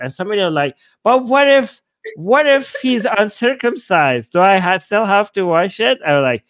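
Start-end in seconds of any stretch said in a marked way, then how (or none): 1.0-6.0 higher, louder imagined objection; mock questioner. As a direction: put on an insistent, higher-pitched query. Imitating someone asking pedantic question.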